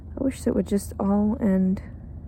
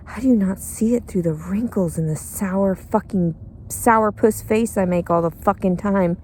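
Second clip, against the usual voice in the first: ordinary talk, nothing marked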